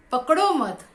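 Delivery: पकड़ो मत joined without a break, high and loud; पकड़ो मत as one phrase. Shown as a demonstration. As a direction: say पकड़ो मत in one forceful unit. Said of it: "उच्चारण कैसा अलग होता है". (पकड़ो मत एक साथ, ज़ोर देकर)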